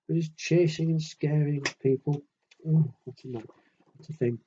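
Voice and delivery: ordinary delivery, nothing marked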